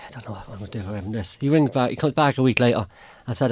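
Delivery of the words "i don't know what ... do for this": whispered to herself, at a loss; barely voiced, helpless; a hushed whisper of despair. Real, not acted. Despairing after the apology demand.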